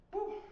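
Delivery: relieved whew. Real, not acted. Exhale after holding exercise pose.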